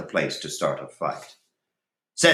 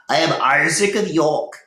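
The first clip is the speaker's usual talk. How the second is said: a hint of an old man, higher and louder; Isaac stating; 8 semitones higher, 6 dB louder than usual. A narrator's reading. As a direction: announce yourself with dignity. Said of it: "he stated".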